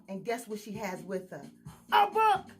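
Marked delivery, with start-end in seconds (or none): none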